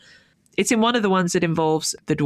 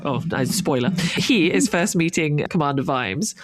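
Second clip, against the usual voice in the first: ordinary talk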